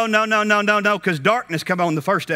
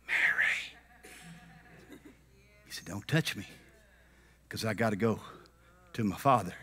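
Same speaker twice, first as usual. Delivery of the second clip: soft voice; 8 semitones lower, 13 dB quieter than usual. Acted. Jesus quietly.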